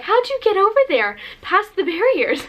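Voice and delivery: high puzzled friends' voices; imitating surprised friends. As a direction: friends baffled she got past the barriers. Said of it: "my friends were like".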